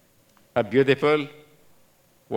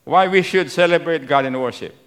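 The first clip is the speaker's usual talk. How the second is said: ordinary talk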